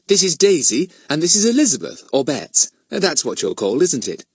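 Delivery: lightly voiced friendly mother; Missus Thomas, friendly hostess. A narrator's reading. Woman introduces two visiting girls to her sister, friendly. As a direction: make polite introductions.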